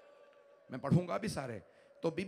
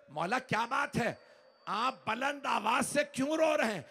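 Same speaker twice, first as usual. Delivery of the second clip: raised question; 5 semitones higher than usual. Acted. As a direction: call the question out in alarm. Fizza asks loudly. (ऊँची आवाज़ में बेचैन सवाल)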